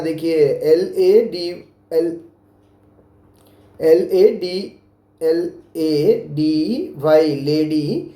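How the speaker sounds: slow dictated spelling; spelling aloud. Shown as a demonstration. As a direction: spell L A D Y one letter at a time. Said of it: "ऐसे लिखना है".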